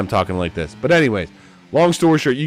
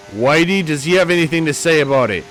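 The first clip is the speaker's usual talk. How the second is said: normal talking voice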